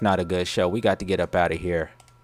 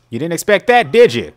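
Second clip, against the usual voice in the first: taunting; 7 semitones higher, 10 dB louder than usual. Acted. Taunting victim after scare.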